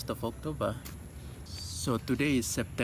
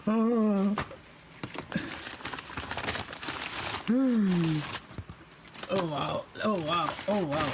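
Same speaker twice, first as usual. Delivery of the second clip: surprised delight; 10 semitones higher, slower than usual. Real, not acted. Opening a gift.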